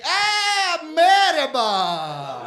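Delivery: huge, high, drawn-out hearty hello; shopkeeper's greeting. Retold or imitated. Doner guy greets a supposed fellow Turk, exuberant. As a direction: bellow a long exaggerated welcome. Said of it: "I walk in, the guy looks at me".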